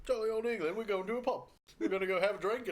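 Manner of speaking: lifted, jolly mock-British voice; bad English accent. Riffing on a pub trip, hammy English accent. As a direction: ham it up as a pub-bound Brit. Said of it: "we're terrible at the accent".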